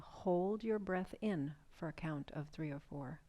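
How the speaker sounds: soothing breathwork guide; guided breathing meditation. Acted. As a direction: ease them into the breath, unhurried. Leads a guided breathing exercise.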